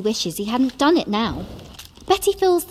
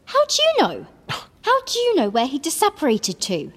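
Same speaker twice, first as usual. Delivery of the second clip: challenging; 13 semitones higher than usual. A narrator's reading. Hermione challenges Ron.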